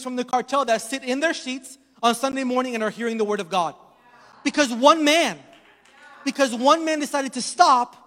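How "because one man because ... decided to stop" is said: loud, rising shout at the climax; preacher's shout. Acted. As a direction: hammer home one man's choice. Sermon climax.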